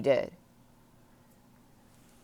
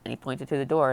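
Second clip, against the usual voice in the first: ordinary delivery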